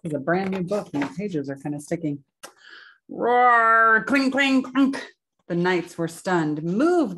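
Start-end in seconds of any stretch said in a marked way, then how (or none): 3.1-4.0 loud, high held mock roar; dragon roar. Dragon battling the king, loud mock roar. 4.1-5.0 loud, high metallic word-noises; clashing swords. Acted. Reader voicing the battle, loud clanging. 6.6-7.2 raised, higher urgent call; storybook shout. A narrator's reading. Knights to Boy in the battle, shouting. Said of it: "they shouted".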